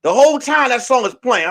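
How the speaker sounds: ordinary talk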